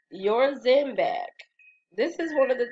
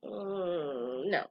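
sassy refusal; 3 semitones lower, 8 dB quieter than usual. Retold or imitated. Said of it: "I was sassy".

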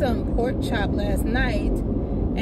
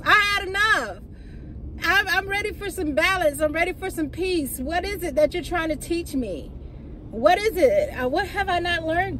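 higher, pleading, voice close to breaking; a weary woman crying out to God for mercy; tearful plea; 6 semitones higher than usual. Real, not acted. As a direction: beg God for mercy, near tears. Pleading with God about trials.

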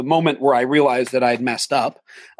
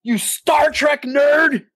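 high, slow, wailing mock insult; 12 semitones higher, much slower than usual. Drunk actor hurling a final insult, high slow wail. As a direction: drag out the insult like a tantrum. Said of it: "she ends it with".

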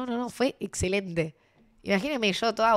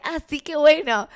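high, drawn-out polite "oh great"; feigned interest; 6 semitones higher, 5 dB louder, slower than usual. Retold or imitated. Drummer humouring a drunk fan, feigned interest. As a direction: humour them, imitating fake interest. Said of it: "le chupa tres pitos y medio".

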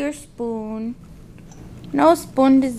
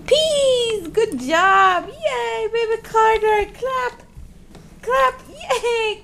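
excited praise; 9 semitones higher, much slower than usual. Real, not acted. Mother praising baby for eating peas, excited high cheering. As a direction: cheer him on, bright and high.

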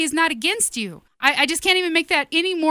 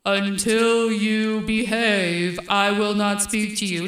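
slow, drawn-out mock voice of God; put-on deep God voice, stretched out and solemn; deep booming voice; 4 semitones lower, slower than usual. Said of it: "maybe I should say in a deep, booming voice".

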